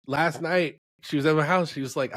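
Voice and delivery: ordinary talk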